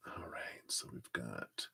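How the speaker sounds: breathy aside under her breath; whispering. Real, not acted. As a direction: whisper it to yourself. Mutters the time to herself.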